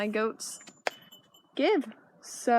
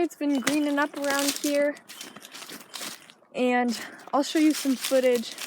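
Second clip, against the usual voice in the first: normal talking voice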